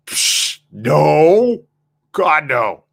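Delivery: loud, too-quick denial; mock denial. Husband asked if he cheated, loudly brushing it off. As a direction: scoff it off like it's absurd.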